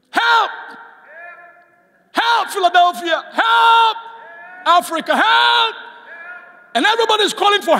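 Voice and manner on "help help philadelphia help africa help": shouted, pitched-up pleas of help; desperate cries for help. Retold or imitated. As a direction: cry out for help like a desperate king. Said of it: "the king makes a comment".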